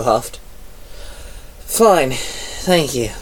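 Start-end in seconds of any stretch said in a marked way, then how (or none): 1.7-3.1 slow sighing reply; sighed. A narrator's reading. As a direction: breathe it out tiredly, slowly. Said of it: "Penny sighed".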